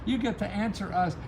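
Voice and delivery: ordinary talk, nothing marked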